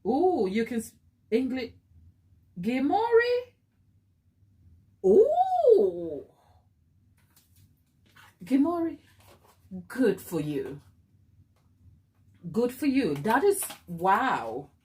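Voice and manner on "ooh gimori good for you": ordinary delivery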